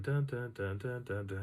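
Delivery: unbroken brisk string of "da"s; quick footstep beat. Retold or imitated. Walker demonstrates own two steps for one, quick beat. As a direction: patter out steps at double the follower's rate. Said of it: "and I'm going".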